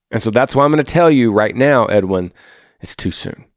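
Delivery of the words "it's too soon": soft whisper. Real, not acted. Gentle warning to heartbroken teen.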